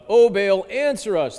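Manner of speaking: loud pleading call to Baal; imitating a desperate cry. Preacher voicing the prophets of Baal, loud desperate plea. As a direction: cry out like the prophets begging their god. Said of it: "they cried".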